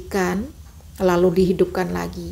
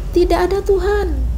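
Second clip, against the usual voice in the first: high put-on shout; mock shout; 9 semitones higher than usual. Teacher imitates someone shouting the phrase. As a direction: cry out the words, shocked. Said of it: "Masa teriak".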